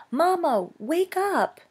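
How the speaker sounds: put-on high, pleading young voice; urgent child's call. Gosling calling his shut-down mother awake, high and urgent. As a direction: call out high to wake her.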